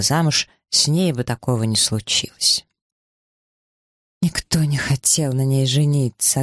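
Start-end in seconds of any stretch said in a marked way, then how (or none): none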